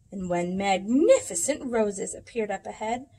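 ordinary delivery

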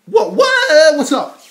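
high, very loud whoop of a greeting; hype yell. Pretend. Rapper to his crowd, hyping up with a yell. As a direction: holler it with a drawn-out second word.

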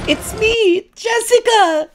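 very high, loud squealed impression; shrieky high-pitched character mimicry; Jessica from The Hot Chick. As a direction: squeal it out in a hammed-up movie voice. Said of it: "From the hot chick".

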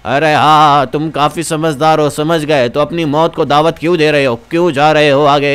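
put-on low old man cautioning visitors; low-pitched elder character; warning old villager. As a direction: play an old local warning of mortal danger.

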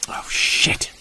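breathy whisper of dread; whispered curse. Acted. Hunter whispering as the stench arrives. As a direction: let it slip out under the breath. Creature smell reaches camp.

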